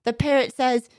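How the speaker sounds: ordinary talk, nothing marked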